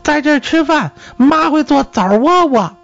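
put-on high-pitched little boy's voice. A narrator's reading. Boy inviting a guest to stay for dinner, high child voice. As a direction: pipe up high, like a small boy. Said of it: "男孩说".

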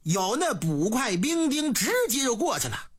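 plain reading voice

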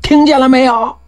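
very high-pitched narrated hollering; calling out. A narrator's reading. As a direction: yamen runners calling across to a village. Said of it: "衙役们跟着呼叫".

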